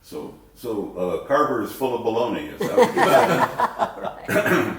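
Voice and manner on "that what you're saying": teasing, laughing through the words; joking question with a laugh. Real, not acted. Teasing joke about bologna.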